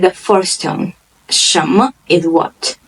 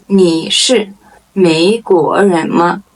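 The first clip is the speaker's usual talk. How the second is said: deliberate, separated syllables; slow model question; slower than usual. Shown as a demonstration. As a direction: ask it slowly for learners. Model question, slow first take.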